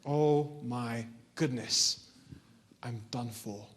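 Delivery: very slow, low, sinking voice; doomed resignation. Acted. Boy hearing wolves all around him, doomed resignation. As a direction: drag it out as if all hope is gone.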